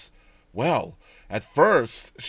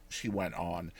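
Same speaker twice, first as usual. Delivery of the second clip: usual speaking voice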